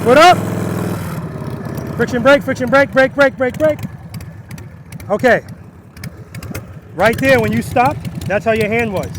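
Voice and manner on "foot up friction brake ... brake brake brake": called out. Real, not acted. Calling to rider across lot.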